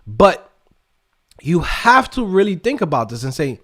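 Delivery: usual speaking voice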